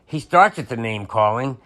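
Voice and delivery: ordinary delivery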